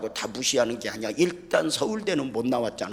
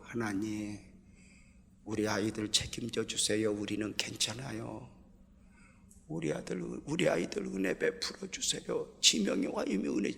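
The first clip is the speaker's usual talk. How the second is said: tearful prayer; 6 dB quieter than usual. Retold or imitated. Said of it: "울면서".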